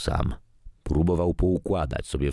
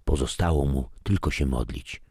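ordinary delivery, nothing marked

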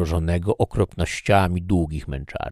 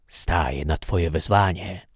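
plain reading voice

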